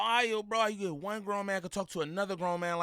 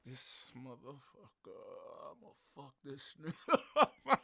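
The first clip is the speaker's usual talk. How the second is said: much softer, slow imagined thought; muttered threat; 5 semitones lower, 7 dB quieter, slower than usual. Retold or imitated. Host voicing Rack's silent fury in a low slow mutter. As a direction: say it under your breath, slowly. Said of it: "He like".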